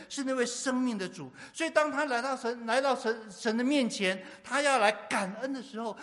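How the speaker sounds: loud, high-pitched sermon delivery; impassioned preaching. Real, not acted. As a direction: preach at full force, driving each phrase home. Preaching at full force.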